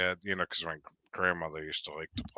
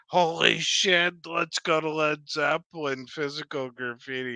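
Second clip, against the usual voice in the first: excited aunt; 8 semitones higher than usual. Retold or imitated. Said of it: "is going".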